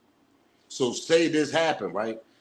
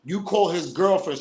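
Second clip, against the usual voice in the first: normal talking voice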